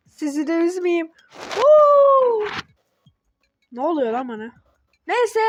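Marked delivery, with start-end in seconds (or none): 1.5-2.5 loud, high drawn-out "hoo"; playful high hoot; sudden whoop. Pretend. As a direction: let out one long high whoop. Sudden whoop after sad aside.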